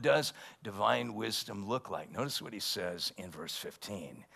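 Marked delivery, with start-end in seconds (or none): none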